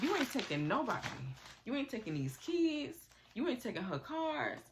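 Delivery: imitating a woman getting in a man's face; mock angry confrontation. Amanda in Michael's face, a mock furious confrontation.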